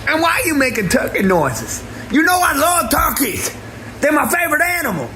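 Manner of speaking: high shouted hurt rant; mock furious. Brother screaming at Andrew for turkey noises, hammed-up fury. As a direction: yell like a betrayed turkey lover.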